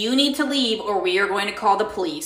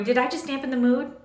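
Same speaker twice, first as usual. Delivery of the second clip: usual speaking voice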